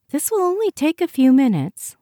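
high, loud and good-natured technician voice; chirpy technician. A narrator's reading. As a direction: chirp it as a breezy clinician reassuring a patient. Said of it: "and chirped".

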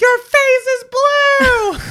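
high, loud, slow alarmed cry; alarmed mom. Retold or imitated. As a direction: shout it out in horror. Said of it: "And she's like".

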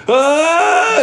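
high, loud hammed-up scream; mock scream. Actor ramping wheelchair over a pond, hammed-up scream. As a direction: yell as the wheelchair flies.

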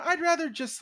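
high, whiny inner voice. Retold or imitated. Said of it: "part of me is like".

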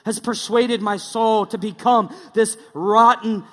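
loud, hammed-up old-English prayer; mock King James prayer. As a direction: declaim it like an archaic preacher. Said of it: "don't talk in King James".

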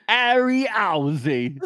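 slow, high put-on British voice; hammed-up suave Brit; mock British accent. Introducing himself as Harry, slow, high mock British accent. Bond-style intro bit.

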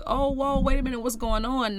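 startled double-take, played lightly; mock alarm. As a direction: act out being caught off guard. Acts out people caught off guard.

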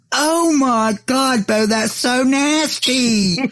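high, slow, drawn-out disgusted gasp; shocked nurse practitioner. Retold or imitated. Nurse practitioner reacting to Bo's gag joke, high and slow in disgust. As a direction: play the appalled nurse, stretching every word. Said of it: "looks at me and goes".

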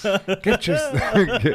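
high, hyped pitchman voice; drug ad announcer. Pretend. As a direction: sell it like a TV drug commercial. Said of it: "if a drug company marketed acid".

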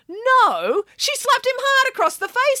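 high, emphatic storyteller pig; Nanny Piggins voice. Acted. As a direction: deliver the twist with gusto.

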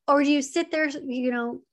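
usual speaking voice

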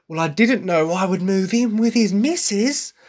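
imitated sharpness, pitched high and pointed; sharp British delivery. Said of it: "a sharpness to her voice when she says that".

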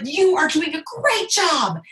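high, slow, emphatic praise; excited doctor. Retold or imitated. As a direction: imitate a doctor cheering, each word stretched. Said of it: "he was so excited".

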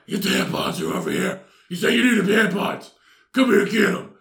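hoarse, loud superhero rasp; Batman. Acted. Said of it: "I get my best Batman voice".

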